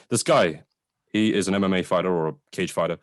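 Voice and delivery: ordinary delivery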